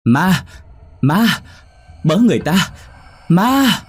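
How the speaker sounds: high, loud cries for help; terrified scream. A narrator's reading. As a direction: scream out in panic at a ghost. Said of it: "Chín sợ quá la hét lên".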